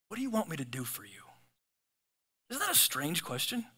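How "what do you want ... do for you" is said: gentle. Retold or imitated. Jesus asks gently.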